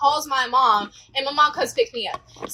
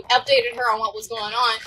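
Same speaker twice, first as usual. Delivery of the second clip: ordinary talk, nothing marked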